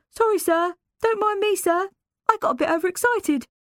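put-on overexcited boy. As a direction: gabble the sorry cheekily.

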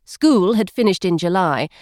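ordinary delivery, nothing marked

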